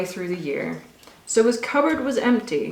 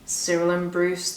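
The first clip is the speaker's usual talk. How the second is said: usual speaking voice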